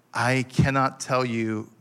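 normal talking voice

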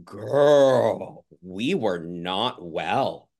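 put-on loud "Girl" then a slow, dry drop; looking at old stories, teasing her past self; sassy aside. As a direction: talk to your past self like a sassy friend. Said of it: "And I'm looking and I'm like".

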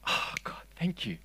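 hushed, breath-only prayer; acted whisper of relief; whispered thanks. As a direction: whisper gratefully to God. Acting a private grateful prayer.

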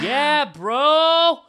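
high, stretched dude voice; a party-happy surfer sprite drawling dudes; fairy greets the party in surfer talk, high and stretched; excited stoner fairy. Acted.

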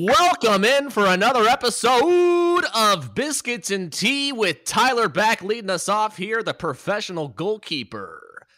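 big, slow show-host welcome; announcer intro. Acted. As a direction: announce it like a broadcast opener. Hosts the show opening.

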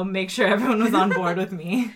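laughing through the quote; giggling while quoting. Retold or imitated.

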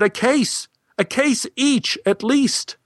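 high, slow, firm insistence; Kari's voice. Acted. Kari insists on a case each, high and firm. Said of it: "She said".

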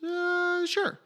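high-pitched, hesitant uh, sure; hesitant agreement. Retold or imitated. Novelist asked to write his idea as a book, imitated hesitant high yes.

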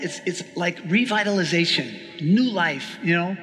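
normal talking voice

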